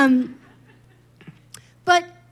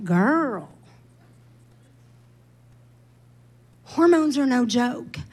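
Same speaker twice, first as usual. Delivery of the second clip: quiet wry self-talk, under the breath; self-talk aside; 4 semitones lower, 6 dB quieter than usual. Retold or imitated. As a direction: tell yourself the truth, half to yourself. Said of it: "I was like".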